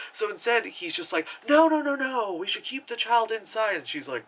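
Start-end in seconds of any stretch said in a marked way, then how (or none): 1.5-3.8 higher-pitched, hurried-in alarm; retold panicky no-no-no; mock urgent protest. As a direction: act out an anxious objection. Husband's urgent plea in retelling.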